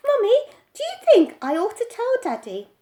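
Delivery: put-on high, eager little boy asking; a small boy asking his mother; child's voice. A narrator's reading. Child voice of Jeremy James.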